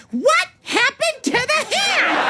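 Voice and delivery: slow, very high incredulous squeak; Michelle's voice memo. Retold or imitated. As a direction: drag it out high, like a shocked friend. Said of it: "she goes".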